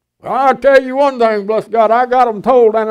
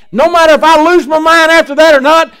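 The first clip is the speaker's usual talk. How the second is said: loud, high preacher's shout; full-throated pulpit cry; fiery preaching shout; 7 semitones higher, 7 dB louder than usual. Real, not acted.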